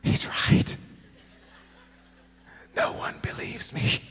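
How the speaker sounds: stunned mock whisper; breath-only, hammed-up disbelief; horrified whisper. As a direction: whisper it slowly, as a dawning realization. Horrified realization as a child.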